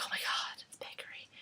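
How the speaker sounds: whispered gushing fans; hushed fake reverence; whispered mock awe. Vlogger whispers, mocking people gushing over the famous bakery. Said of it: "everyone's like".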